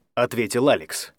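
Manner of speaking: ordinary delivery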